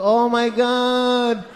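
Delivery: put-on high, drawn-out gushing over a girl; creepy feminine fawning. Older Lebanese guy greeting girl, creepy feminine gushing. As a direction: coo loudly, stretching every phrase. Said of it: "they always get feminine for no reason".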